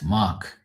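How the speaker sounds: "Mark" with no R, like "Mahk"; Bermudian Mark without R. Shown as a demonstration. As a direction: drop the R in "Mark", long open vowel. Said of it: "with a R".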